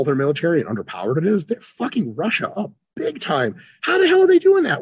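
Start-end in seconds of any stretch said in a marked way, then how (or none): 3.8-4.8 high, loud rhetorical amazement; loud, high mock astonishment; amazed. Real, not acted. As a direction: fling it up loud and high in disbelief.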